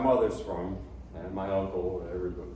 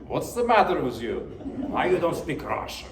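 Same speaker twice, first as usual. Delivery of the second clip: mock Russian-accented scolding, higher and louder; playful Russian accent, higher and louder; 7 semitones higher, 4 dB louder than usual. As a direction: imitate an indignant Russian aunt in a Russian accent. Imitating indignant Russian relatives.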